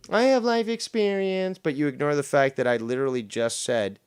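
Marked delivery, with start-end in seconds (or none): 0.1-1.6 high, whiny parroting of her words; sing-song mock echo; mocking imitation. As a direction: mimic her to belittle her. Mocks Shannon's words back at her.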